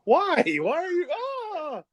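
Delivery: high whiny yelp of betrayal; mock anguished wail. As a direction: wail it high like you're wounded. Mock hurt wrestler whining.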